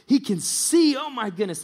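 high, loud burst of amazement; excited. Retold or imitated. Preacher voices the healed blind man's joy, excited. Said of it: "and he's so excited".

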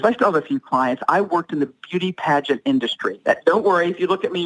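ordinary talk

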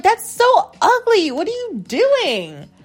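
high-pitched mock disgust; appalled reaction. Woman retelling her reply to the accent, high appalled squeal. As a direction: squeal it in exaggerated dismay.

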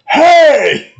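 sudden, high, loud holler; Chris Farley shout. Retold or imitated. Bus driver yelling after a kid throws something, shouted. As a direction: imitate an outraged comic yell at full volume. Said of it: "Farley just turns around and goes".

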